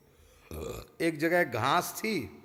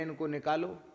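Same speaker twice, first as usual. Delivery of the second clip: usual speaking voice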